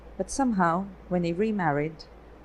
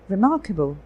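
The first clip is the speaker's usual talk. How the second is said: a louder, admiring exclamation; an amazed exclaim, louder than the narration; 6 dB louder than usual. A narrator's reading. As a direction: burst out in amazement. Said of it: "Feluda exclaimed".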